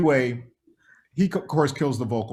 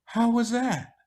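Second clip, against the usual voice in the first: Michael impression; 9 semitones higher, slower than usual. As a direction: lift the voice into a gentle high Michael. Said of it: "he goes".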